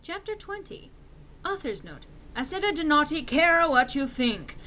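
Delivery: ordinary delivery, nothing marked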